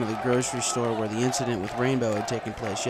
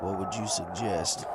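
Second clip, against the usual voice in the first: subdued; 4 semitones lower, 5 dB quieter, slower than usual. A narrator's reading. Marty yields.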